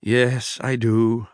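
ordinary delivery, nothing marked

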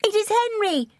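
put-on very high, delighted girlish cry; young princess exclaiming; excited cry. A narrator's reading. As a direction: cry it out high as if spotting someone dear. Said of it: "she cried".

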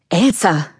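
plain reading voice

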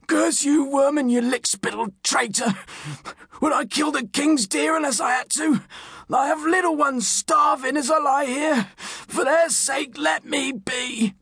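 high, strained cursing turning to pleading; wounded, desperate poacher. A narrator's reading. Wounded poacher begs sheriff's man, desperate and strained.

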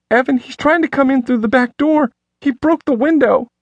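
high, frantic woman's voice; terrified wife on the phone; panicked woman. A narrator's reading.

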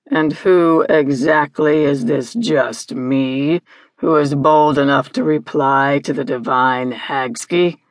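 low, slow, imperious villain voice; deep, drawn-out menacing challenge; Hagski. Acted. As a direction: demand an answer as a haughty, horrid creature. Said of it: "the voice demanded".

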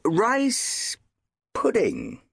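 a warning, don't push me; warningly. Acted. Chief of police warning the storyteller, measured and stern.

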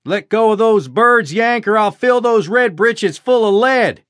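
loud, high, slow threat yelled across the farm; shouting. A narrator's reading. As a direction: bellow it slowly at the Yankee on the roof. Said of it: "shouted the Johnny".

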